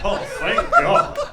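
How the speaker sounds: slow laughing thanks to God; drawn out through laughter like a prayer answered; laughing mock relief. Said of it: "He's like".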